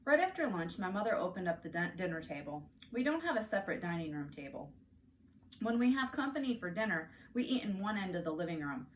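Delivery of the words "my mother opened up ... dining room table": ordinary delivery, nothing marked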